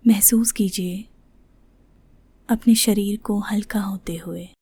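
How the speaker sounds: soft, unhurried voice with long pauses; guided meditation. Shown as a demonstration. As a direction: gently guide them into the breath. Leads breathing meditation. (ध्यान कराती कोमल, ठहरी हुई आवाज़)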